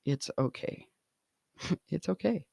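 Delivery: quiet, soft and gentle, almost whispered; soft reassurance. Shown as a demonstration. Podcaster soothing a worried newborn mom, soft and quiet. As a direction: reassure her gently and softly. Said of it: "what would I want to whisper her".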